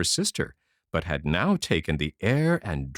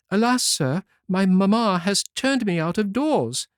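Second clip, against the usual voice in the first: higher, plaintive lament; girl weeping to the king's son; sorrowful girl; 11 semitones higher, 4 dB louder than usual. Acted. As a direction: tell the prince your woe. Outcast girl tells the prince.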